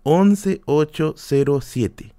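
once ocho cero siete, each number drawn out slowly; slow, spelled-out score. Shown as a demonstration. Telling friends his brother's rival's score, slow and deliberate. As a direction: lay out once ocho cero siete one digit at a time. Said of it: "Así".

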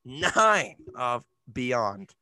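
slow, drawn-out title reveal; slow dramatic. Acted. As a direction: stretch the episode title out.

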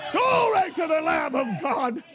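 full-throated revival preaching, rising to a shout; preaching at full force. Real, not acted. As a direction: preach it hard and build the fire.

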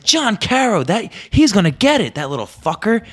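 high, loud mock fury; fuming threat. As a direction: vow revenge at the top of the voice. Kid fuming at prankster friend.

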